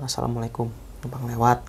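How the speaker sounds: soft, low greeting to unseen spirits; hushed respectful greeting. Retold or imitated. Narrator softly greets spirits on the mountain path, hushed and respectful. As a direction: quietly ask passage, not wanting to disturb.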